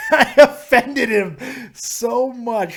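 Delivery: loud, high and slow through laughter; laughing. Real, not acted. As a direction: laugh hard through the words. Caller hung up, host laughs.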